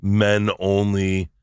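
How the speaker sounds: slow drawled men only; drawn out. Retold or imitated. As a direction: stretch it out wistfully. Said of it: "I was like".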